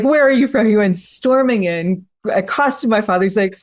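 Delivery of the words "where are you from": higher, louder indignant question; mock indignant demand. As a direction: play the neighbor demanding answers. Angry neighbor confronts father.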